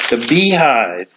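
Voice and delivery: The Beehive said slowly and deliberately, as a correction; careful correction. Real, not acted. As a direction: restate The Beehive carefully, drawing it out. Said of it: "pardon me".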